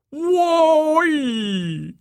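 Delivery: long, high, loud mocking whoop; derisive. A narrator's reading. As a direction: jeer with a drawn-out exclamation. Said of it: "Joey said derisively".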